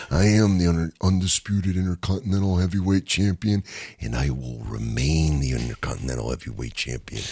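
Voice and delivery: low, quiet raspy champion boast; Macho Man impression. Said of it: "where he goes".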